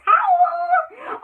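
high, loud howl; high loud wolf howl. Acted. Read word howl.

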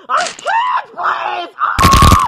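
high wail breaking into a loud cry; hammed-up anguished outburst; desperate wailing. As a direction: break down mid-plea and howl. Father breaks down begging.

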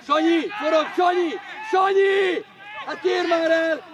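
high, full-voiced yell; shouted call. Real, not acted. Spectator urging Sanyi to turn, shouting. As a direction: shout the name insistently across the pitch. Calling player during match.

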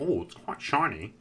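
lightly voiced fussy British oddball; eccentric nerd. Scientist inspecting his chocolate, mildly eccentric voice. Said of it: "He was a little eccentric".

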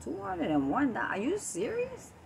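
incredulous at the price; shocked disbelief. Retold or imitated. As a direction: pitch jumping up on serious. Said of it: "I'm like".

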